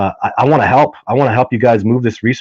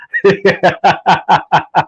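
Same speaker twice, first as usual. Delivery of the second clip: real chuckling run of ha-ha-ha, higher pitched; hearty laugh; 5 semitones higher than usual. Real, not acted. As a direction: laugh heartily at your own anecdote. Amused by own border anecdote.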